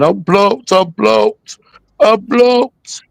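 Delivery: high whiny mock sob, mocking the blocked user; mock crying baby. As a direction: whine it like a crybaby. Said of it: "Fucking cry, baby".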